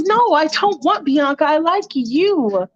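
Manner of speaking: high-pitched pleading reassurance; earnest Michael. Acted. Michael reassuring Allison he likes her, high earnest plea. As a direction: insist up high, stretching the end. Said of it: "And Michael's like".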